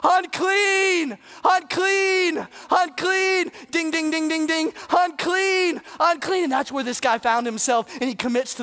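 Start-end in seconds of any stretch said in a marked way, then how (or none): 0.0-6.4 shouted confession, pitched high; shouting. Retold or imitated. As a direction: cry it out to the whole room. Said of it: "shout at the top of your lungs".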